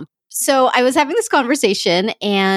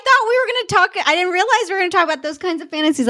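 high, rapid, laughing protest; 8 semitones higher, faster than usual. Real, not acted. Laughing to the co-host about fantasies she didn't expect to share. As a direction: talk fast through real laughter. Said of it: "I'm laughing 'cause".

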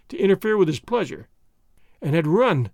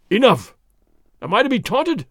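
a louder burst on Enough, then an offended question; a big soldier who's had enough; lightly voiced indignant sergeant; angry cry; 5 semitones higher, 4 dB louder than usual. A narrator's reading. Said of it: "the big sergeant cried".

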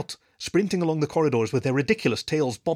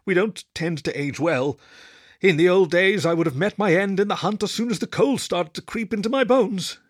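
higher, louder aging wolf voice; old wolf lament; 7 semitones higher, 4 dB louder than usual. Acted. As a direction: let the old wolf rise as he remembers. Old wolf Wolford speaks.

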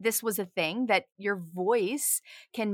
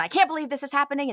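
fast, higher, louder mock outrage; soapbox rant; 6 semitones higher, 5 dB louder, much faster than usual. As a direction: act out a ranting friend on a soapbox. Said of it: "we go on these rants".

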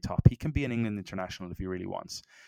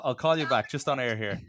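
dodging a caller; 5 semitones higher than usual. Real, not acted. Sister FaceTiming mid-podcast.

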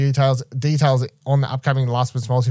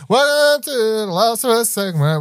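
slow, higher hammed-up show host; announcer voice; 9 semitones higher, slower than usual. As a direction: welcome them like a TV host. Opening a show segment.

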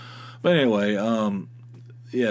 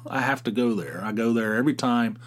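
normal talking voice

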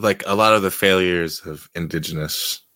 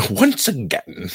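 a slow, jokey British "once again"; mock British; 6 semitones higher, slower than usual. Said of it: "I became British for a second".

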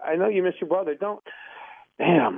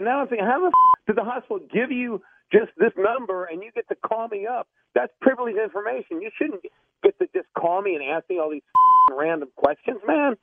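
worked up; 6 semitones higher than usual. Real, not acted. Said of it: "Now you're starting to get me worked up".

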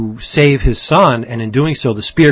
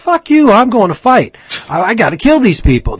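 imitating a defiant daughter, higher and louder; 10 semitones higher, 5 dB louder than usual. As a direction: retell her outburst as a hammed-up yell. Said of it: "And she's like".

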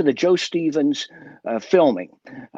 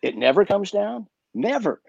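higher, incredulous repeat of the words; surprised echo; 7 semitones higher than usual. Retold or imitated. Security guard echoing the young magician in surprised disbelief. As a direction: echo it back in disbelief, pitched up. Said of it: "and he goes".

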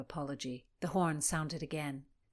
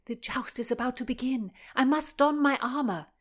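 urgent; 5 semitones higher, 7 dB louder than usual. Acted. Hurrying off before the joust.

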